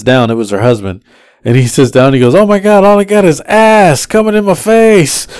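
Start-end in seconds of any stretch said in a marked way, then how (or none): 2.3-5.3 loud, high-pitched griping; mock outraged complaint. Husband squashed in the back seat complains, mock outraged. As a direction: imitate a squashed passenger crying out.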